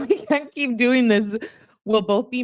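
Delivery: high, giggly read-aloud; real laugh breaking into the words; laughing while reading. Real, not acted. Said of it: "I'm laughing about the cat comment".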